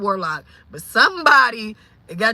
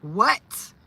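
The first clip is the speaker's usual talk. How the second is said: ordinary delivery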